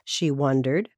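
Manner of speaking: usual speaking voice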